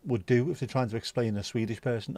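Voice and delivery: usual speaking voice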